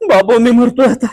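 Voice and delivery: loud, high cry for help; panicked wail. Acted. Man crying out over his lost mobile, panicked wail. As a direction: wail in comic despair over the loss.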